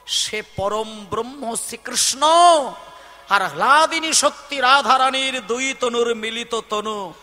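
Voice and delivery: fervent preaching. Acted. Preaching goddess's words.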